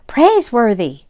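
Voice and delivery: loud, rising call of a name; excited exclaim. A narrator's reading. Jack hits on the idea, calls to the butler, excited. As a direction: blurt it out as the idea strikes. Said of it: "he exclaimed".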